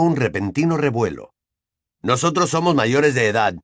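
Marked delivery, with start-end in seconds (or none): none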